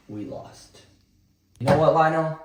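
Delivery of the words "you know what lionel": exasperated outburst. Acted. Turning on his own plan.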